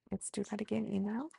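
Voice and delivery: gentle yoga instructor voice; guided breathing cues. Acted. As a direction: talk them calmly through each breath. Leads a guided breathing sequence.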